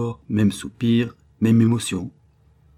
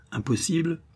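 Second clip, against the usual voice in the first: incredulous echo; 8 semitones higher than usual. A narrator's reading. Stranger questioning the boy archer's boast, incredulous. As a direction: throw it back as a surprised question. Stranger echoes Robin in disbelief.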